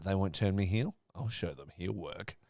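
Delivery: low, quiet scheming Yo, lightly voiced; mocking Yo. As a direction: mutter it like a villain plotting, understated. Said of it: "he's like".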